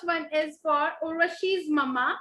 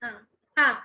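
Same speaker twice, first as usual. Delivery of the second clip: usual speaking voice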